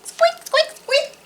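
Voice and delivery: very high squeaky mouse. A narrator's reading. Mouse inviting the squirrel, very high squeak. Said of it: "said Mouse".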